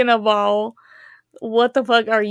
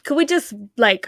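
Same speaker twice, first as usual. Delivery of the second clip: ordinary talk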